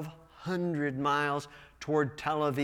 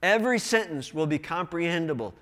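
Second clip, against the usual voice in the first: ordinary talk, nothing marked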